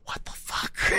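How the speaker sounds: baffled, voiceless whisper; whispered disbelief. Real, not acted. Reader baffled by the story, whispered aside.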